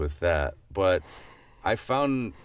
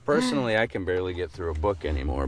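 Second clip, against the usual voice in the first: ordinary delivery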